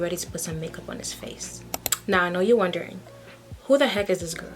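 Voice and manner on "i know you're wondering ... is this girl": normal talking voice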